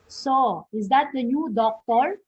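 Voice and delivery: "is that the new doctor" climbing at "doctor"; rising intonation. Shown as a demonstration. Teacher demonstrating a yes-no question, voice rising on "doctor". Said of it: "your question is going up or rising".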